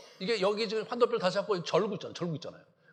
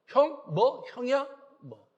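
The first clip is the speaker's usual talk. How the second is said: indignant retort; 6 semitones higher, 5 dB louder than usual. Retold or imitated. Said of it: "이렇게 하면".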